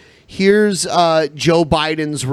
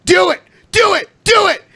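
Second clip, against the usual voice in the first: shouting; 5 dB louder than usual. Real, not acted. Urging viewers to like stream.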